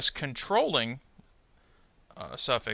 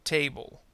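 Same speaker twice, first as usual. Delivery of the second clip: "Table" with TA strong, ble weak; first-syllable stress. Shown as a demonstration. Teacher gives two-syllable noun example, "Table" stressed on TA. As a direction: put the beat on TA in "Table". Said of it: "stress on the first syllable".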